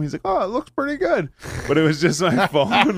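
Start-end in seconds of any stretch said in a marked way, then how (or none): 0.3-1.3 high put-on stranger. Stranger quoted, far timbre. 1.7-3.0 laughing. Real, not acted. Laughing mid-line.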